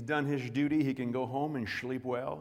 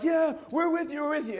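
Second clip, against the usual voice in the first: eager crowd; 14 semitones higher, 6 dB louder than usual. Retold or imitated. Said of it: "they're all like".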